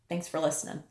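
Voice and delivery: ordinary delivery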